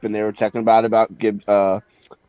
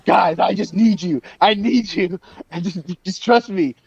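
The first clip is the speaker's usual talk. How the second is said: high pleading coach impression; mock the coach begging his team; imitating the coach; 7 semitones higher than usual. Friend mimics the coach begging players, high pleading. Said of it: "The coach is like".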